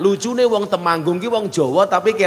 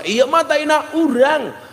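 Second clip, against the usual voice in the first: higher, slow, calmly owning it; Sundanese villager reply; 11 semitones higher, slower than usual. Retold or imitated. Sundanese villager answering the ngapak man, lightly voiced calm. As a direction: answer like it is nothing. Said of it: "Dijawab sama orang Sunda".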